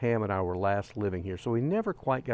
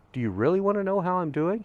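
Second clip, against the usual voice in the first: higher-pitched, probing retold question; retold earnest question; 8 semitones higher than usual. Hiker turns around to a passing stranger, earnest question. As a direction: lift the voice, asking in earnest.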